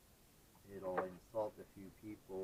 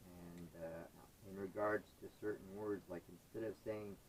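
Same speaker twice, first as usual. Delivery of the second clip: usual speaking voice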